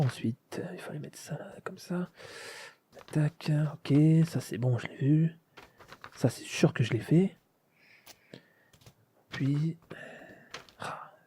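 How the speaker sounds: muttering to himself. Acted. Alone, muttering while assembling eye.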